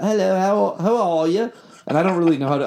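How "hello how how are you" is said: high-pitched put-on English accent. Musician answering the radio host on a bet, fake English accent. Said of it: "in an English accent".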